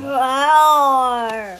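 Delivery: very loud, drawn-out roar; tiger roar. Acted. Pupils play the little tiger, roaring loudly and long. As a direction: roar at full volume like a tiger.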